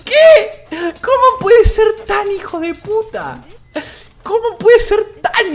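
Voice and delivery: very high, slow squeal of astonishment; hysterical disbelief. Real, not acted. Streamer asking how anyone can be so dirty, hysterical disbelief. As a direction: gasp it out high, nearly laughing. Laughing shock at rival's trick.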